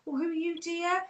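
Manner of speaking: a hint of a dotty gran asking who you are; confused grandmother. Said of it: "I could just be saying".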